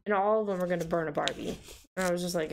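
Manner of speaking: normal talking voice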